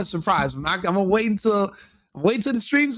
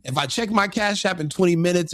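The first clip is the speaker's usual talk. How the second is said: ordinary delivery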